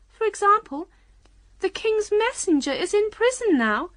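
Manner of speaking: very high, emphatic Queen voice. A narrator's reading. Queen boasting of remembering future events, self-assured.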